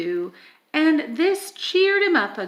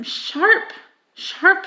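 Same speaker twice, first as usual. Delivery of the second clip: sharp, sharp rising higher each time; rising emphasis; 5 semitones higher, 3 dB louder than usual. A narrator's reading. Reader lifting each sharp as the towers grow. Describing needle-pointed towers.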